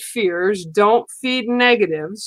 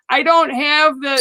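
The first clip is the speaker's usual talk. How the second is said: ordinary talk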